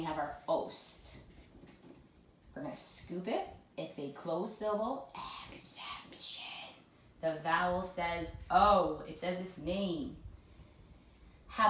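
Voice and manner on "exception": hushed, unvoiced whisper; playful whisper. Shown as a demonstration. Teacher naming the spelling rule to pupils, whispered. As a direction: whisper it like a secret keyword.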